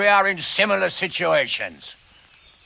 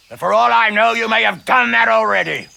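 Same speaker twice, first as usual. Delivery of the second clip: raised challenge; 7 semitones higher, 6 dB louder than usual. Acted. Hanush counters Toth's threat.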